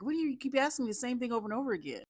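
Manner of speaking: high, fast exasperated voice. Retold or imitated. Madison annoyed at drunk Joe repeating himself, high fast exasperated. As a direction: rattle it off fed up. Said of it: "she's like".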